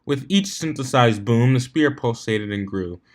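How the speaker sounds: ordinary delivery, nothing marked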